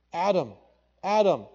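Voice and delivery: high, loud call of Adam; God calling out. Acted. God searching for hiding Adam, a loud high call. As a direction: call across the garden for Adam.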